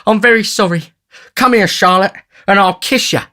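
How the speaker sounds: high, loud oafish sweetheart; high-pitched amorous character voice; tipsy wooing youth. A narrator's reading. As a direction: invite her boldly, pitched high. Tipsy Noah courts Charlotte.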